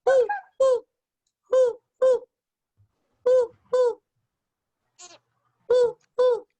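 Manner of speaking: high, loud owl hooting. Shown as a demonstration. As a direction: hoot like an owl. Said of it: "here's another one".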